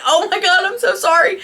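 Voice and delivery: high, flustered retold apology; mock mortified apology. Clerk apologizing to the husband as retold, mock mortified.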